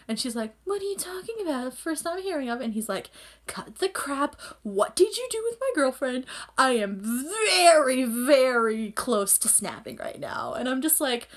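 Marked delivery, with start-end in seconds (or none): 0.6-2.6 high, softish mock denial; put-on innocent act, lightly done; feigned innocence. Retelling Akito denying the push. 3.5-10.5 raised pitch, drawn-out VERY; retold warning, slowing heavily on the threat; mock angry threat. Haru confronting Akito over his girlfriend.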